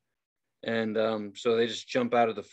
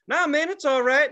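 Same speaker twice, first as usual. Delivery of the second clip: dismissive; 12 semitones higher, 5 dB louder than usual. Retold or imitated. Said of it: "they're all like".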